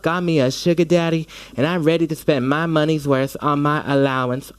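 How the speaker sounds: Southern drag queen dame accent, sassy and gossipy. Shown as a demonstration. Actor demos her Southern accent for the interviewer. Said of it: "if y'all wanna hear my Southern accent".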